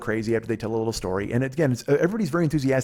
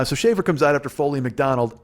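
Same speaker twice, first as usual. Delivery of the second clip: ordinary talk, nothing marked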